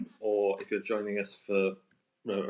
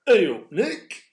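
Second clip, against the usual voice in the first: high, loud mock regional greeting in Alan's accent; exaggerated local-accent impression; imagined regional accent; 10 semitones higher, 8 dB louder, slower than usual. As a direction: put on his regional accent, pitched up and loud. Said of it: "That's his accent, I, I imagine".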